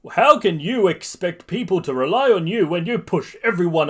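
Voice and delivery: loud, high scolding hero; All Might. A narrator's reading. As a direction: lecture him in a big heroic voice. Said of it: "All Might shrugs it off and says".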